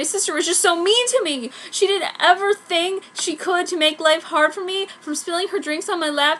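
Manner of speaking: whiny. Acted. Whiny complaint about sister.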